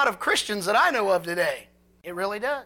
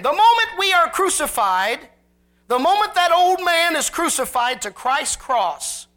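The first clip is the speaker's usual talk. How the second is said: higher, louder preaching build; preaching at full force; 8 semitones higher, 7 dB louder than usual. Acted. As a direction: drive the point home from the pulpit. Preaching climax.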